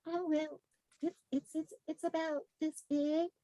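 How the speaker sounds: sheepish client. Retold or imitated. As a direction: hem and haw like a shy client.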